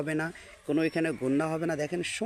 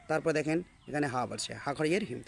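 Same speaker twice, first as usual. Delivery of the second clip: usual speaking voice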